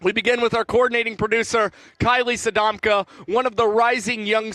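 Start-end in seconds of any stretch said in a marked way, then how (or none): none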